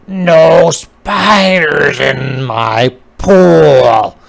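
loud, slow frog voice like a talking horse; croaking frog. A narrator's reading. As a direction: bellow it like a grumpy frog. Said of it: "sound kinda like a horse talking".

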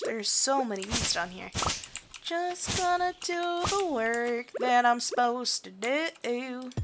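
normal talking voice

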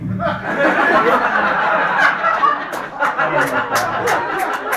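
huge audience laughter; crowd laughter. Real, not acted. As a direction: crowd roaring. Crowd laughs at heckler bit.

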